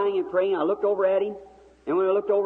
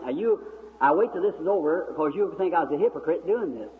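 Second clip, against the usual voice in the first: ordinary talk, nothing marked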